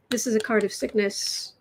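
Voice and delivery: usual speaking voice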